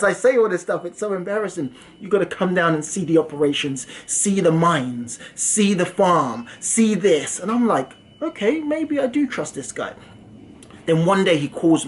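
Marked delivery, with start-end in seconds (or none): 2.0-7.4 slow insistent pitch. Retold or imitated. Scammer insisting on the visit. 8.2-9.9 mock naive. Speaker voicing own past gullibility.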